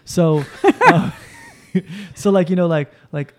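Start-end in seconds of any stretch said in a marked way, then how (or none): none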